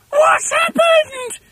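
very high, loud, drawn-out shriek; piercing wail of shock; horrified scream. A narrator's reading. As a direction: scream it slowly in horror. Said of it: "screamed Missus Twit".

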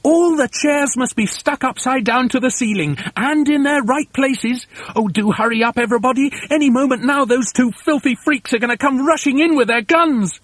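high, urgent stream of orders; high-pitched frantic foreman; overexcited. A narrator's reading. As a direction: chivvy everyone on in breathless excitement. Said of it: "I'm so excited I hardly know".

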